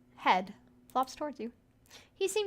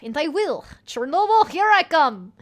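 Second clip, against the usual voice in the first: much higher, louder joyful cry; excited cheer; 5 semitones higher, 11 dB louder than usual. Acted. Gregor cheering about going to Chernobyl, excited and loud. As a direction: cheer it like a tourist off on holiday.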